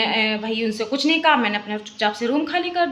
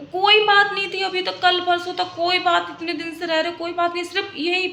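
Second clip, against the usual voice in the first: exasperated outburst; 6 semitones higher than usual. Real, not acted. Venting about neighbour, rising anger.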